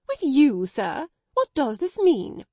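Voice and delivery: loud, high outraged shock; stunned and offended; amazed indignation. A narrator's reading. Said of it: "amazed indignation".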